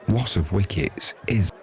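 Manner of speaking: usual speaking voice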